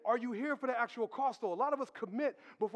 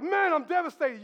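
mock devastation; 5 semitones higher, 7 dB louder than usual. Mock reaction to losing PlayStation.

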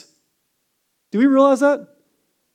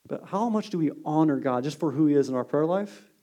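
usual speaking voice

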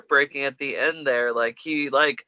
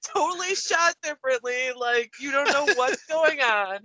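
laughing; 9 semitones higher than usual. Real, not acted. Laughing disbelief at scene.

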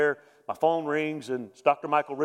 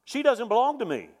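raised-pitch "She doesn't belong to me" with more force; high, louder realization; 9 semitones higher, 4 dB louder than usual. Retold or imitated. Husband reliving his realization in the hospital, raised and firm. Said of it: "That's exactly what I thought".

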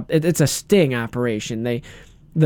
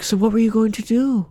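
calm mock interrogator, higher pitch; Chris Hansen; 7 semitones higher than usual. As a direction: ask evenly in a put-on high voice. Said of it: "he talks all calm".

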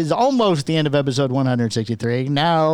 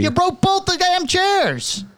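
mock yelling; 14 semitones higher, much slower than usual. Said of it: "I appreciate you yelling at me".

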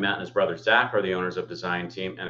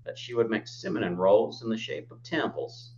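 exaggerated Utah accent, temples as tamples. Shown as a demonstration. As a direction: say it like a Utahn, tamples. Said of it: "you kinda have to say it in a Utah accent".

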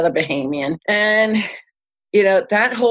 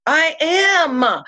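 pitched-up, defensive I am; flustered protest; 9 semitones higher than usual. Retold or imitated. Snapping back at the shouting captain, pitched up and flustered. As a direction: insist you're already doing it.

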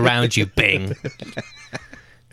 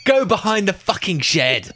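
high angry ranting; angry high yelling; 8 semitones higher than usual. Acted. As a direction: bawl him out furiously.

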